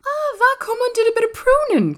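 very high, loud excited lad; high-pitched Yorkshire exclamation; crying out. A narrator's reading. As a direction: cry it out in delighted realisation. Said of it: "cried Dickon".

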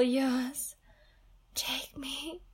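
breathy surrendering whisper; soft whispered offer. Acted. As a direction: give yourself up in a hush. Surrendering to her hero.

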